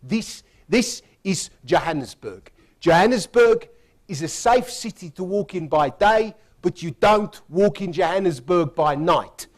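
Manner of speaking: a huge Afrikaans security man, done badly; self-confessed bad Afrikaans accent. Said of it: "do the Afrikaans accent really badly".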